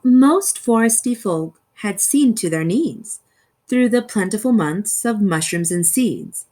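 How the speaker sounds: ordinary delivery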